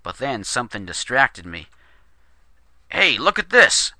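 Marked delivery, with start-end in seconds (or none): 2.9-3.9 sudden high, loud call; startled shout pointing out the window. A narrator's reading. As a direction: shout it across the room to grab attention. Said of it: "Murphy shouted".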